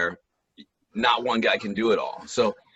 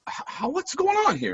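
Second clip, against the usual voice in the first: much higher, stammering disbelief; baffled surprise. Retold or imitated. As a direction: caught off guard by the emails. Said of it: "And I'm like".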